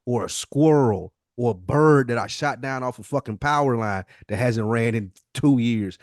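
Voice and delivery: normal talking voice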